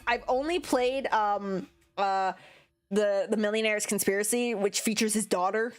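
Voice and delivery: ordinary talk, nothing marked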